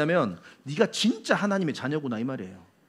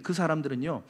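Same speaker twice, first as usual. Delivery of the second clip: normal talking voice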